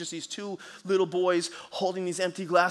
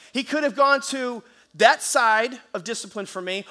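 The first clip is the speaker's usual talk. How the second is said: normal talking voice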